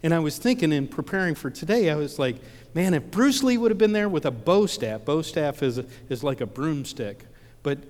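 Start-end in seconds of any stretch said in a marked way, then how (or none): 2.8-5.0 high, loud eager wish; light up at the thought of a hero, louder and higher; excited wish. Retold or imitated. Wishful thought of his hero.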